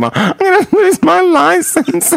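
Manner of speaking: high, wailing fake sob with laughter; mock crying. Retelling her friend wailing about losing her license, mock crying. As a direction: squeal it half laughing through mock tears. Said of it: "started crying".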